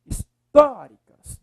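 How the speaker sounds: "Históricas" with TÓ loud, high and drawn out; his-TÓ-ricas, slow with a big second syllable; stress on TÓ. Shown as a demonstration. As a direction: punch and stretch the TÓ in "Históricas". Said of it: "tó, ó".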